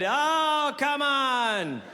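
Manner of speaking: high, drawn-out groan of protest; high put-upon whine; mock exasperated. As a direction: act wounded by a friend's betrayal. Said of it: "I said".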